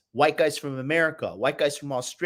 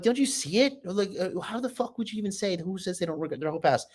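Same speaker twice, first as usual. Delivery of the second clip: higher, softer mock distraught woman; imitating crying girl; 5 semitones higher, 4 dB quieter than usual. Retelling girls who cried in front of him, high mock distress. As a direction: mimic a girl breaking down, hammed-up. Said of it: "chicks break down in front of me crying".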